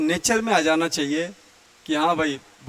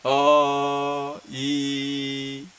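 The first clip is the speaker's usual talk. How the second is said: mid-pitch vowels; 3 semitones lower, 4 dB quieter than usual. Shown as a demonstration. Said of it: "और जो बीच से बोला जाए".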